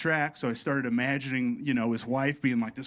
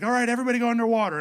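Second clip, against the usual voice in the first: high, loud, fast group order; instructor calling out; 9 semitones higher, 5 dB louder, much faster than usual. Retold or imitated. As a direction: imitate a hurried dive instructor. Retold instructor addresses the dive group.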